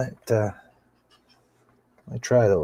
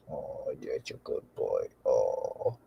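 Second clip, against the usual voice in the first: soft cooing; 10 semitones lower, 12 dB quieter, slower than usual. Real, not acted. Soothing the parrot.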